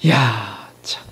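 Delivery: breathless whispered sigh of dismay. Real, not acted. Preacher whispering his dismay at Peter's denial. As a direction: let it out as a whisper.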